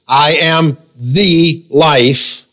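"the" stretched to "thee", standing alone; very slow, emphatic "the" before "life"; stress on THE. Shown as a demonstration. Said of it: "He did not say, I am a way".

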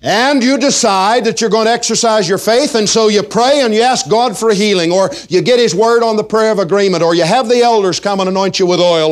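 preaching. Acted. Pastor exhorting his congregation, full preaching voice.

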